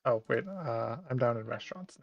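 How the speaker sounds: normal talking voice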